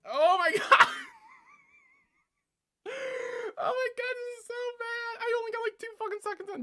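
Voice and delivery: very high, loud cringing squeal; mortified shriek-laughing. Real, not acted. Reacting two seconds into her own old video, mortified shrieking. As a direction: shriek it through real laughter. 'cause I'm gonna cringe so hard.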